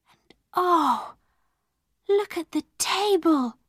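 high, marvelling voice; breathless wonder. A narrator's reading. Sara discovering the fire and table are real, amazed. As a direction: swell from hushed disbelief to excitement.

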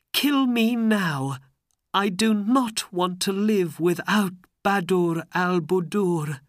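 high, anguished begging; tearful plea. A narrator's reading. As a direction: plead desperately, close to tears. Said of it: "There were tears in his eyes".

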